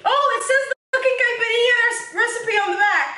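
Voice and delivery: surprised laugh at the recipe; laughing surprise. Real, not acted. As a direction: laugh as you find it.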